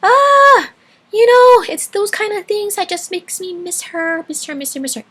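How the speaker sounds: real emotion about a lost grandma; choked up. Real, not acted. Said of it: "I'm getting all choked up".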